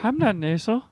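softly put-on Jeff voice, lightly defensive; a mild gentle denial in character; quiet light Jeff persona; Jeff impression. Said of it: "It's a little more gentle".

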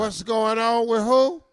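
put-on slow, loose drunk question; drunk sister. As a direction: play dumb and tipsy. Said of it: "I'm the drunk sister".

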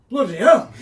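loud Yorkshire exclamation; Yorkshire accent. Retold or imitated. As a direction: shout it like a Yorkshireman. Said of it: "a guy with a very thick Yorkshire accent who's like".

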